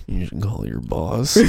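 low, slow, creepy magician; slow it down and drop it low; creepy David Blaine. Acted. David Blaine pestering a worker, low, slow and creepy. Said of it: "Yeah, in his creepy voice".

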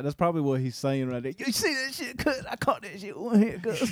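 high-pitched boastful trash talk; bragging football player. Retold or imitated. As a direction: crow like a player who just made the catch.

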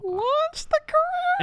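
very high, slow, disbelieving echo; can't believe the claim, say it aghast; incredulous. Real, not acted. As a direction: cohost echoing a Wikipedia claim in mock disbelief, high and slow. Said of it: "I don't know what to say".

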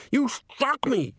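very high, drawn-out outraged scream; high, slow cry of outrage. A narrator's reading. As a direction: shriek it slowly, aghast at being hit. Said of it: "screamed Downey".

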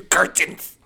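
CURTAINS barked in a German accent; mock German accent. Shown as a demonstration. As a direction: shout "Curtains" like a cartoon German. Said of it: "you put curtains with a German accent".

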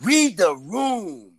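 each word stretched very long; drawn-out proclamation. Pretend. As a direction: proclaim the rule, very slowly. Proclaims the new stereo rule.

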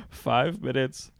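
normal talking voice

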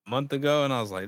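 ordinary talk, nothing marked

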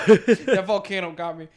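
laughing through words. Real, not acted. Laughing after distraction.

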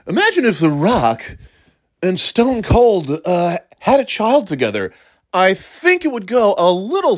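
hammed-up, measured wrestler promo delivery; Stone Cold-Rock blend. Shown as a demonstration. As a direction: talk like two wrestlers' styles crossed. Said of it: "doing a Stone Cold and Rock imitation".

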